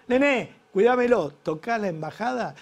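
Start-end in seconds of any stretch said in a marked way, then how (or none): 0.1-1.3 high, loud call across the street; calling out to the car guard. Retold or imitated. Argentine calling the car guard, loud and high. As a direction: shout a quick favor to the car guard. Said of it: "le decimos al trapito".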